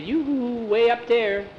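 high, drawn-out yoo-hoo call. A narrator's reading. Short boy to the tall newcomer, high call.

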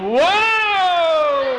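high, drawn-out yell of alarm; hammed-up horrified "whoa"; long shocked whoa. Skeeter looked down at his shorts, shocked yell.

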